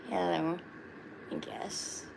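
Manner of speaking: just a hint of a shy little turtle character; low-key Chip the turtle greeting. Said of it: "There, I did the Chip voice".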